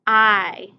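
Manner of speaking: loud, clear teacher model of I; loud model word. Shown as a demonstration. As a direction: call it out for the class to echo. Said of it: "Say it with me".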